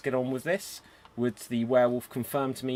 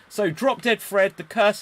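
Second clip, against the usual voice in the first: dramatic call-out; 8 semitones higher, 6 dB louder than usual. Acted. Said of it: "GM addresses cursed player".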